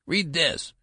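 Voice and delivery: high, desperate command; desperate cry. A narrator's reading. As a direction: urge it desperately in a treble. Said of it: "cried Benjamin desperately".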